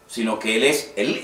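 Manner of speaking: normal talking voice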